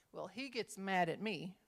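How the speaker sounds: usual speaking voice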